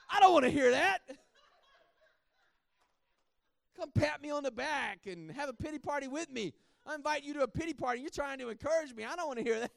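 high, loud, laughing mock rant at the pastor; mock furious yell. Preacher replaying his angry reply to the pastor's text, hammed-up yelling. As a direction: ham up a tantrum, half laughing. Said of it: "I was mad".

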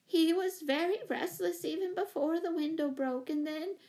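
high, worried explanation; flustered owner, pitched well up; moaning, fretful. A narrator's reading. Owner excusing her restless monkey, in a fretful moan. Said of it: "moaned Miss Giddings".

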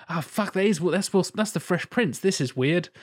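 acted jolt of recognition; startled realisation. Film viewer suddenly recognises the famous actor, startled.

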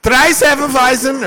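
loud, high mock German order; German accent. Film fan imitates a German ordering drinks, mock German accent. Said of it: "like a real German fuck".

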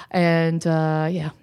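ordinary delivery

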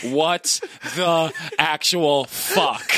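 very slow, word-by-word disbelief; slow, emphatic, dumbfounded; stunned disbelief. Retold or imitated. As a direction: stretch each word out in mock shock. Acts own stunned reaction.

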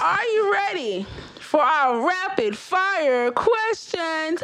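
slow, drawn-out host announcement; game show host. Pretend. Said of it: "I was trying to".

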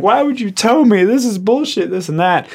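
high-pitched mock outraged protest; retold indignant complaint. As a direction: imitate an upset customer demanding answers. Retold customer arguing over towing.

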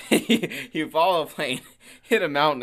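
real laughter bubbling through the words; laughing. Real, not acted. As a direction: pitch an absurd idea through giggles. Laughing through his absurd suggestion.

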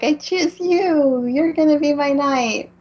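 hammed-up choosing of a champion; princess's proclamation. Acted declaration choosing a knight.

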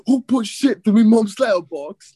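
high, loud British street hooligan; mock an outraged British hooligan, pitched right up; British hooligan accent. Quoting a favorite video to friends, British hooligan accent. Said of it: "the street hooligan British accents".

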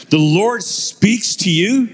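put-on slow, high, incredulous English accent; mock English accent, stretched and rising at the end. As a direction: draw it out like an astonished English professor. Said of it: "in his English accent".

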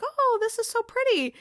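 high-pitched imitation of delighted gushing; gushing admiration. Keeley is handed a gift at the party, imitated gushing.

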